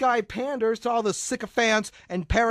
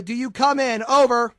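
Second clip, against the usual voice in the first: loud radio call; 10 dB louder than usual. Pretend. Radio call sign to partner.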